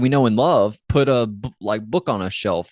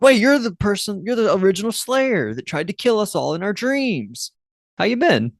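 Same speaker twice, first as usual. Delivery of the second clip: high, chirpy imitation of a chatty girl; imitated innocent small talk with a scary figure; Willow; 10 semitones higher than usual. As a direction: pitch it up, brightly guileless. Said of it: "this part from Willow when she's talking to the Slayer".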